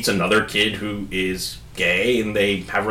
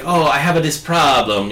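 put-on Italian accent; 5 semitones higher than usual. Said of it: "in a very Mario-like accent".

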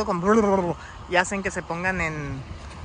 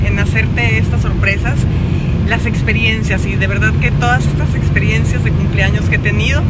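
louder, emotional birthday thanks; heartfelt thanks; 10 dB louder than usual. Real, not acted. Thanking her followers on her birthday, loud and heartfelt. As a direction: thank everyone with full heart.

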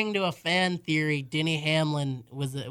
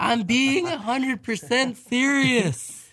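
cracking voice; 8 semitones higher, 6 dB louder, slower than usual. Real, not acted. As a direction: voice cracks up high. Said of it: "Your voice cracked a little there".

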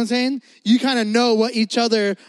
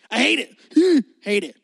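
exaggerated rant; 3 dB louder than usual. Real, not acted. Ranting about painting.

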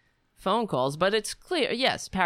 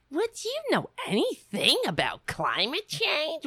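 mocking; 11 semitones higher than usual. Mocking the robocaller's question.

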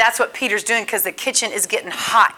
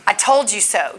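contemptuous sneer; 5 semitones lower, 4 dB quieter than usual. Retold or imitated. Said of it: "he's not locking eyes like going".

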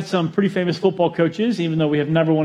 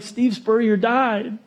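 slow, higher mock-tragic line; mock grave news; 6 semitones higher, much slower than usual. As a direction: deliver it like a tragedy, slowly. Grave dream news retold.

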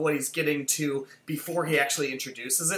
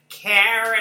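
imitating a mom calling for Karen, raised voice; mock call through the house; 5 semitones higher, 8 dB louder than usual. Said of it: "She's like".

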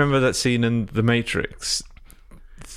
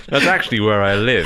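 laughing through the words; 7 semitones higher, 4 dB louder than usual. Real, not acted. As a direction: say it while cracking up. Laughing at own joke.

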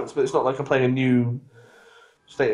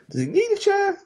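incredulous, much higher-pitched query; baffled question. Retold or imitated. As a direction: retell it as a squeaky, baffled question. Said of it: "and we're like".

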